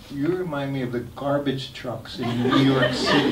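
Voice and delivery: a laugh breaking into a retold joke; laughing while quoting. Teacher teasing the little girl, laughing through the words. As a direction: quote the teasing line through a chuckle.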